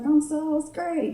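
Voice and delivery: put-on high, self-satisfied kid voice; little kid proud of their own joke; cocky six-year-old. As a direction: crow like a child who just nailed it. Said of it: "all that cocky confidence that kids have".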